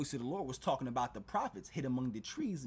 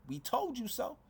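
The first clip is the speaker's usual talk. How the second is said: much higher sing-song taunt; mocking told you so; 11 semitones higher than usual. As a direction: rub it in like a schoolyard jeer. Preacher taunts those who didn't listen.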